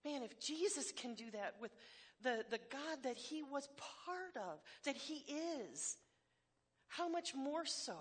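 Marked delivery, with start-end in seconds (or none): none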